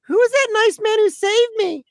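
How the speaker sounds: put-on high sweet toddler voice; little girl asking. Toddler in the back seat asking her dad, high and sweet. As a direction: ask it high like a three-year-old.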